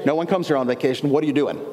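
low, quick mimicry of a wary local; standoffish islander. Islander questioning a vacationer, mock standoffish. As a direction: play a local doubting the visitor's story. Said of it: "they were very territorial and standoffish".

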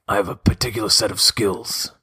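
a hint of a grim threatening hero, unhurried; Liam Neeson impression. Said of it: "character voice of the week I'm gonna ask you to do is Liam Neeson".

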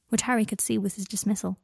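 plain reading voice

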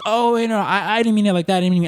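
high, flustered backpedaling; lightly mocked embarrassed customer; flustered woman. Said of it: "And she goes".

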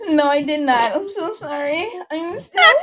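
high, squeaky-sweet character apology; Katie. Acted. Character protesting to co-hosts, high put-on voice. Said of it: "Katie's no longer here anymore".

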